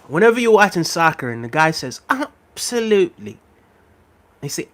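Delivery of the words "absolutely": high, slow "Absolutely" with a drawn-out stress; British commentator. Retold or imitated. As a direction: mimic a British football pundit stretching "Absolutely". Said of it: "They put such emphasis on the absolutely".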